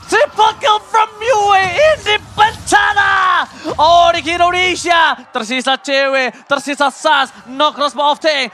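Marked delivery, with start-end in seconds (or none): 0.1-5.0 high, slow, shouted kill call; hype shout. Real, not acted. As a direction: stretch out the big moment. Caster calls a triple kill. (teriakan komentator yang panjang)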